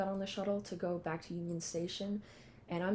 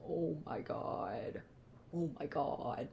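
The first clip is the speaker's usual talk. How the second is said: awed tearful whisper; 2 semitones lower, 5 dB quieter, slower than usual. Retold or imitated. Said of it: "kept crying".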